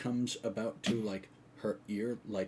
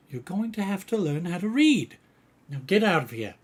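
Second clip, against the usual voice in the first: raised, louder motherly scolding; loud, higher telling-off; scolding healer; 9 semitones higher, 9 dB louder than usual. Acted. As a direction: send a reluctant child off firmly. Healer firmly scolds reluctant girl.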